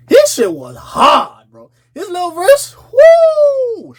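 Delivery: high, slow hype with a whoop; hyped. Real, not acted. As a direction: whoop it up over the verse. Hyping [name] verse.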